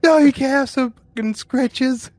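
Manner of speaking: high, slow mock-angry refusal; mock snarl. Quoting Sam Rockwell denying the dying man: high slow mock snarl. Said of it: "Sam Rockwell's like".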